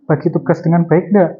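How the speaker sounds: ordinary talk, nothing marked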